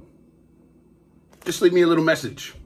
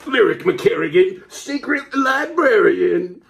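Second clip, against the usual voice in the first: high dramatic title call, stretched out; announcer voice; 10 semitones higher than usual. Acted. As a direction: call the hero's name grandly. Closing title announcement, sung.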